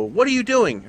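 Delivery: mock nagging ex-wife. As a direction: mimic a nagging wife's demand. Ex nagging, acted quarrel.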